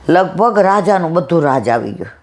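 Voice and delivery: usual speaking voice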